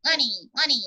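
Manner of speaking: Money, Money said as unny, unny, lips open; ventriloquist money. Shown as a demonstration. Coach showing student money without lips, rising. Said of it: "We go past the N, the M".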